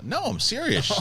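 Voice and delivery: high, over-earnest protest to his daughter; insisting earnestly. Retold or imitated. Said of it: "I go".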